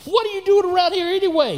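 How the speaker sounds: high, loud scornful demand from the big brother; big brother scolding David, high and loud; demanding older brother. Retold or imitated. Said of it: "he demanded".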